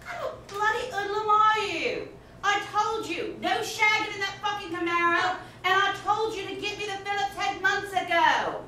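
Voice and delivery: mock British scolding accent. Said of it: "You got a jolly good accent".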